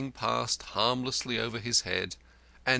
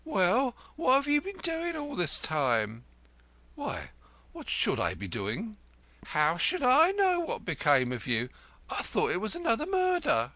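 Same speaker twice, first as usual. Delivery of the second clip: high, sharp questioning; wife demanding where the lodger has been; 1 shrill demand; 12 semitones higher than usual. A narrator's reading. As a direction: pin him down.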